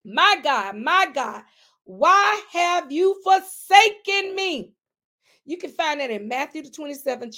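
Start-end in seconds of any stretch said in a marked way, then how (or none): 0.0-4.7 slow, anguished cry to God; cried out plea. Acted. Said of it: "Jesus cried out".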